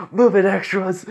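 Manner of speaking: high, loud imitated shout. Son running off orders others aside, shouting. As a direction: yell it to clear the way. Same quote yelled while running off.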